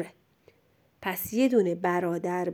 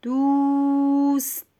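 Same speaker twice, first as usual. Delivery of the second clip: دوست with a long level vowel, higher pitch; slow dictation; 5 semitones higher, much slower than usual. Shown as a demonstration. Teacher dictates for a pupil writing, high and drawn-out. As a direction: stretch the u of دوست, release the t clearly.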